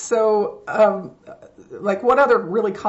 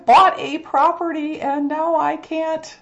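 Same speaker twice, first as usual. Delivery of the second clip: high, slow fluttering cries for help; damsel in distress voice; 11 semitones higher, 6 dB louder than usual. Pretend. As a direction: plead like a helpless damsel. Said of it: "is that your damsel in distress voice?".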